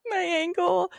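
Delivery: high wail about her ankle; mock crying. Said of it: "I was just crying".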